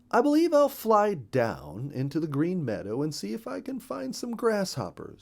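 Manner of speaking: high, lilting storybook bird; Mister Bluebird. Acted. Mister Bluebird telling his wife he'll hunt grasshoppers, high-voiced. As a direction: pitch it up for a bird announcing his plan.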